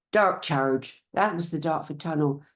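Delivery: ordinary talk, nothing marked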